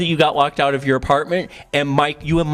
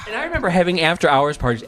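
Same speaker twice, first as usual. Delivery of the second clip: ordinary delivery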